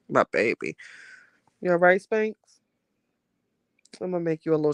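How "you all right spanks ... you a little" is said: higher, gentle check-in with a rising end; tender. Real, not acted. Talking to her old dog.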